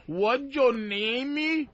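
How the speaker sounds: imitating a Brazilian girl's English; lightly mocking accent; mock broken English. Mimicking broken English hooker.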